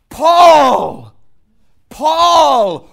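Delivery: very loud, high cry; preacher's roar; shouted name. Acted. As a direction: shout it to the back row.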